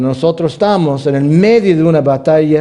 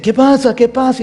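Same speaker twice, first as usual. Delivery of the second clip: higher, louder worried dad; father asking urgently; 12 semitones higher, 3 dB louder than usual. Retold or imitated. Father runs to his scared son, mildly urgent. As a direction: rush in asking what happened.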